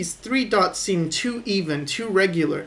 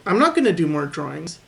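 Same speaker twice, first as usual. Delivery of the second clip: normal talking voice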